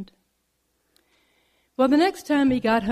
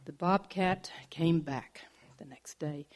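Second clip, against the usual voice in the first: whispered narration; 5 semitones lower, 6 dB quieter than usual. A narrator's reading.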